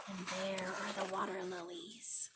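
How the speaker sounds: hushed whispered aside; whispered picture aside. Real, not acted. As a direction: breathe it out like a secret. Quiet aside while showing pictures.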